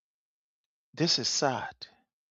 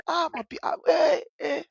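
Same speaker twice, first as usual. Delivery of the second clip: high-pitched mock cries of pain; imitated wailing; 13 semitones higher than usual. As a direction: let out a high wail like the crying girl. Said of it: "the girl crying".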